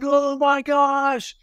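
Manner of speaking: high, drawn-out retold cry of alarm; mock frantic cry. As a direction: imitate a panicked dad, stretching the words high. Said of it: "frantically running, be like".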